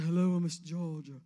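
loud, low pageant introduction; put-on, projected beauty queen voice; generic pageant queen. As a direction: intone it like a polished contestant. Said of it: "She said".

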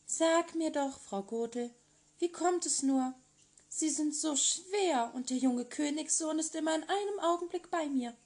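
a shy maiden sweetly asking her prince; the girl in the tower, hopeful and eager; young Rapunzel. Acted. Rapunzel blurts to the witch.

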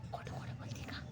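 hushed conspiratorial whisper; very soft, voiceless question; whispered aside. Real, not acted. Parent's aside to child.

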